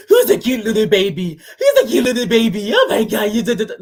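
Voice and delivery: put-on cooing baby talk through a laugh. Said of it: "you gave her the little baby voice".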